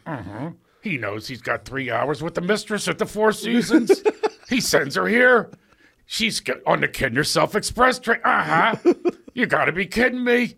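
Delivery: dish the dirt with a sneer; catty whispered aside. Retold or imitated. Teacher whispers insults about a student, high and catty. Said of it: "he would whisper to me".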